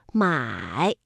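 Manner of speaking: 买 low, dipping then rising; 买 falls right down and swings back up; full third tone. Shown as a demonstration. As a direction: draw a V with the pitch on 买. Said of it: "它是一个V字形的读音".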